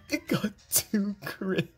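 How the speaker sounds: high, slow, squeaked through laughter; laughing disbelief. Real, not acted. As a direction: crack up while complaining. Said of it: "I honestly can't be mad".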